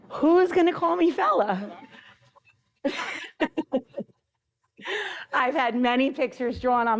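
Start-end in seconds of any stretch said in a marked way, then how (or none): 0.1-2.9 louder, higher, through a laugh. Real, not acted. Teacher repeats joke to retiring colleague, laughing. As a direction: laugh it out the second time.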